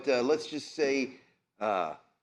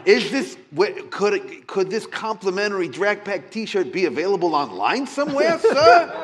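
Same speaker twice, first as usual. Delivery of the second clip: laughing tease; 7 semitones higher, 9 dB louder than usual. Acted. Bob teasing the vampire.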